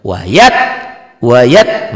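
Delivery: Wayat snapped loud and abrupt; sharp loud bark. Shown as a demonstration. Teacher drilling students, sharp snapped correction. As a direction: hit Wayat hard, barking the t. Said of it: "agak bentak sedikit".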